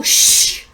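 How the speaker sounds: forceful, voiceless hiss for silence; loud shushing. Acted. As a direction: shush them hard. Player acts hushing the shouter.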